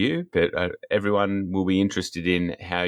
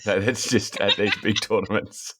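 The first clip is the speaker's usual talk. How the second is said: words breaking into giggles; laughing; 3 semitones higher than usual. Real, not acted. Host riffs on Polish halfling players, laughing. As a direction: try to finish the joke while laughing.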